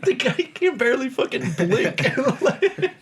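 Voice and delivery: breaks into high laughter mid-sentence; laughing through the words, pitch climbing. Real, not acted. Laughing while describing scene.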